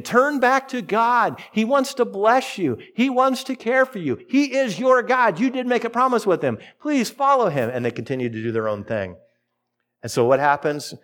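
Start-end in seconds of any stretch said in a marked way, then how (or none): 0.0-7.7 pleading prophets. Retold or imitated. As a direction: beg them gently but urgently to turn back. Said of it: "these prophets were saying".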